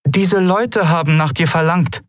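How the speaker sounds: high, cowed mother's voice; intimidated. A narrator's reading. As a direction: announce it timidly, pitched well up. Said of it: "begann ihre Mutter eingeschüchtert". (eingeschüchtert, mit hoher Stimme)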